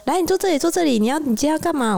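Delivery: high, coaxing hairdresser voice; sweet hairdresser. Retold or imitated.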